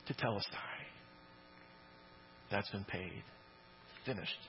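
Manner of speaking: "Tetelestai" said soft and low, almost reverent; hushed. Retold or imitated. Preacher reflecting on Christ's words, hushed and reverent. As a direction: let "Tetelestai" fall quietly, in awe. Preacher softly echoing the cry.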